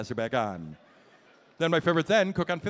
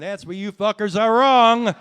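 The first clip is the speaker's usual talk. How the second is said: slow, much louder, mock furious blast; mock angry yell; 6 semitones higher, 13 dB louder, slower than usual. Comic scolds the audience, slow hammed-up yell. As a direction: turn on the crowd with a hammed-up roar.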